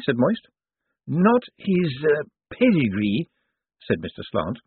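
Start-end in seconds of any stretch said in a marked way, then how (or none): 1.1-3.3 put-on slow, high, dusty old lawyer; zombie lawyer voice. A narrator's reading. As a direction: draw out each word like a zombie. Slant speaks.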